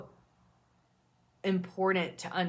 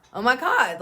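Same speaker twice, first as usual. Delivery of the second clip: louder, higher mock-appalled exclamation; mock shock; 4 semitones higher, 8 dB louder than usual. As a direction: exclaim as a judgmental bystander, hammed-up. Voicing an ignorant person's shocked reaction.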